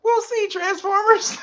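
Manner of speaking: teasing the Transformers in a high playful voice. Pretend. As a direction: taunt them playfully.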